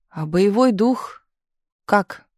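slow, wistful question. A narrator's reading. Misha asking the signal girls, wistfully. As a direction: draw it out with a touch of gloom. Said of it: "тоскливо спросил Миша".

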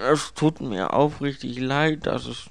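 awkward, apologetic servant; sympathetic, slowing towards the end; murmured apology. A narrator's reading. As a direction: offer regrets after clearing the throat. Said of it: "murmelte er".

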